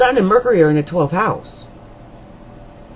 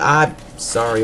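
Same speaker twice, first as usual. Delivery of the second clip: usual speaking voice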